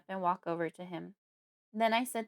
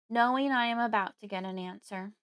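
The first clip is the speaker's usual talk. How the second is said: plain reading voice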